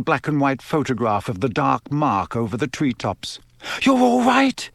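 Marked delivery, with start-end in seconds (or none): 3.8-4.7 high anxious question, barely believing it; high-pitched, distracted relief; distracted, overwrought. A narrator's reading. Mother checking them all over, high and distracted. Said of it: "Missus Weasley muttered distractedly".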